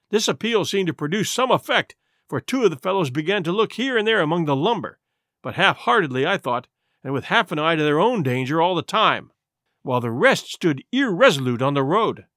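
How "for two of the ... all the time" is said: ordinary delivery, nothing marked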